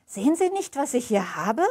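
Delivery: put-on indignant old woman. A narrator's reading. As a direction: show him the bundle, offended. Old woman shows the doll.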